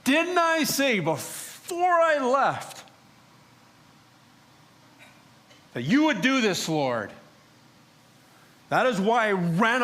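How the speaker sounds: high, loud furious accusation; screamed at God. Retold or imitated. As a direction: shout your grievance up at heaven, pitch climbing. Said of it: "I screamed to God".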